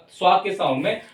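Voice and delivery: usual speaking voice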